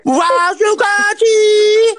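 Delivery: Можно войти wailed very high and slow; squeaky wail. Shown as a demonstration. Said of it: "That's funny".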